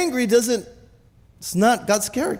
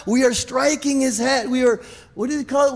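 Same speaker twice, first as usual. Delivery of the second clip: ordinary delivery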